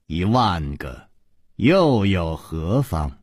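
low, measured, contemptuous woman; cold villainess. Acted. Yinji dismissing other men's lives to Chu Liuxiang, cold disdain.

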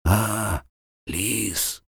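faint rasping croak; hoarse wheeze. A narrator's reading. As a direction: croak it out, dying. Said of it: "прохрипел он".

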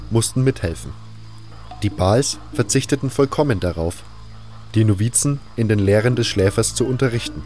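ordinary talk, nothing marked

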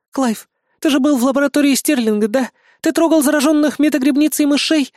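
high, worried woman's voice; concerned young woman's high voice; anxious woman. A narrator's reading. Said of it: "тихо спросила Раиса".

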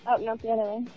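ordinary talk, nothing marked

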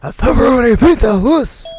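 loud, fast pizza pitch; loud announcing. Acted. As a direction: call out the pizza offer.

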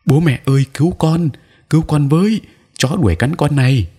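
put-on high-pitched frightened child's yell; crying for help. Boy yelling to his parents that dogs chase him, high panicked cries. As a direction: yell for rescue while running. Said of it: "vừa chạy vừa la hét".

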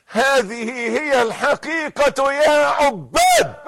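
high, shouted proclamation to the faithful; impassioned preacher's rising roll call; full-force preaching. Real, not acted. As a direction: thunder each address at the congregation.

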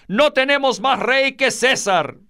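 high-pitched, insistent priests; vehement rejection. A narrator's reading. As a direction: declare it as an outcry. Priests reject Jesus before Pilate. (rechazo vehemente en tono agudo)

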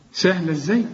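ordinary talk, nothing marked

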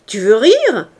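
loud, incredulous question; shouted. A narrator's reading. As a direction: shout it in disbelief. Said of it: "cria Javert".

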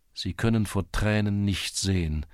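plain reading voice

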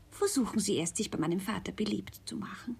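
soft voiceless whisper; hushed advice. Acted. Elise advises her lover in a hushed whisper.

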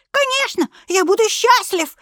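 very high squeaky cartoon mouse. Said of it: "пропищал Микки Маус мультяшным голосом".

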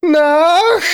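very high, loud scream; wild scream. Acted. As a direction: scream into the void. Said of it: "закричала она в никуда".

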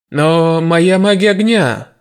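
loud, slow plea; pleading warrior. A narrator's reading. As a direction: beg for the fire magic. Warrior pleads for his magic.

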